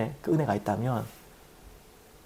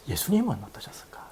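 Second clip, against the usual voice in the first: whisper; 3 semitones lower, 10 dB quieter than usual. Real, not acted. Preacher ponders Jesus' feelings.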